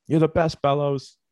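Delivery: high, loud put-on heckle; mock bench chirp. Repeats the bench chirp impression.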